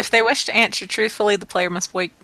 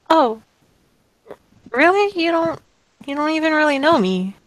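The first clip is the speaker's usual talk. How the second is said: higher-pitched, hesitant protest; bashful disbelief; 7 semitones higher than usual. Acted. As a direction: demur shyly in a lifted voice. Bashful character doubts the compliment.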